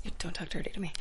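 breathy teasing whisper; mock sultry whisper. Real, not acted. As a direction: hush it like a secret. Joking about Big Mac.

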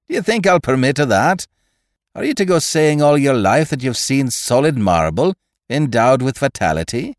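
high, heated Irish scolding; indignant Irish doctor. A narrator's reading. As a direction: berate him as the affronted Irish doctor.

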